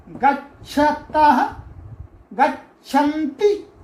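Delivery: slow, stretched गच्छति, गच्छतः, गच्छन्ति; slow recitation. Shown as a demonstration. Teacher drilling verb forms three times for the class. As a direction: chant गच्छति, गच्छतः, गच्छन्ति slowly with rising ends. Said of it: "तीन तीन बार बोलना है आपको".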